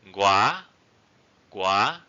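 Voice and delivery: "Gua" sweeps up to a high end; high rising tone. Shown as a demonstration. Teacher models the word for cross, high rising tone. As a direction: push "Gua" upward, very high at the finish. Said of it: "high raising tone".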